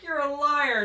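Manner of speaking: high, loud accusing retort; mock accusation. Parent disbelieves old man is the son, mock-outraged accusation. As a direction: throw out a hammed-up accusation.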